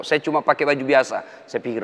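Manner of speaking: usual speaking voice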